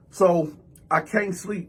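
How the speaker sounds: usual speaking voice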